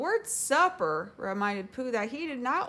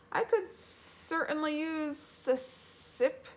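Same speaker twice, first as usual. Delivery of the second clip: high, slow voice with drawn-out S; whistling Gopher; 8 semitones higher, slower than usual. A narrator's reading. Gopher accepting a cup of tea, whistling. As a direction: whistle and stretch the S sounds.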